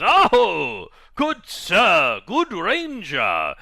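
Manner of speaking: high, loud, slow theatrical greeting; pompous knightly character voice; hearty hailing. Acted. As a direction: boom out a drawn-out, grandiose salute to a ranger. Game master voices Vigilfist hailing ranger.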